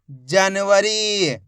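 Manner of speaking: जनवरी very slow, final री held; teacher drawing out जनवरी; blended slow reading. Shown as a demonstration. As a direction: join जनवरी slowly, stretching the ee. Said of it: "एक एक अक्षर का उच्चारण करके".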